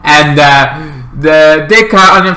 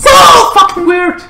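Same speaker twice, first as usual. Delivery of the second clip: high, screechy disbelief; mock outrage.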